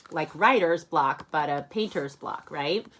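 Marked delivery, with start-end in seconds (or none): none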